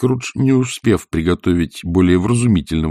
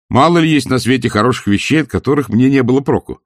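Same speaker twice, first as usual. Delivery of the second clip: higher, animated reply; earnest nephew; 6 semitones higher than usual. A narrator's reading. Nephew answering his profit-minded uncle, animated. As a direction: argue it with spirit.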